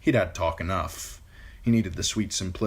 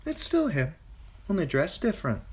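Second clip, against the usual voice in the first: put-on high, matter-of-fact young woman; 11 semitones higher than usual. A narrator's reading. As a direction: state the obvious.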